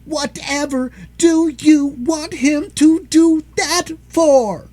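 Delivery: high, loud and slow; high child yell; yelling boy. Acted. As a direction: shout up at the dwarves. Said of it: "yelled George".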